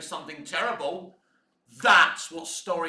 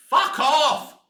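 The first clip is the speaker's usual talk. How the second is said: loud, higher dismissive yell; angry outburst; 6 semitones higher, 6 dB louder than usual. Real, not acted. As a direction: spit it out in disgust. Outburst at twist.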